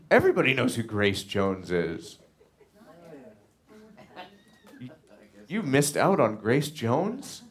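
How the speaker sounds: high, loud, incredulous; can't believe they missed her; mock disbelief. Real, not acted. Telling viewers they must know [name], incredulous.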